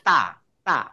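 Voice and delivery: তা said open with air released. Shown as a demonstration. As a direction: push air out on তা. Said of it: "বাতাসটা মুখ থেকে বের হয়ে যাবে".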